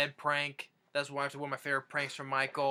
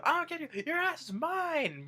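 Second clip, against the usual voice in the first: mock threat; 14 semitones higher than usual. Grandpa going after Michael, mock-furious threatening yell. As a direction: imitate a furious threat, stretching the last words. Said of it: "he was gonna go after Michael".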